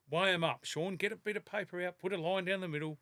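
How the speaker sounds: ordinary talk, nothing marked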